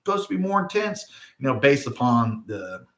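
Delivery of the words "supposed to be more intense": much higher, eager voice of hopeful skywatchers; hopeful people. Retold or imitated. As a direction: mimic folks talking themselves into another chance. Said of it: "So they're like".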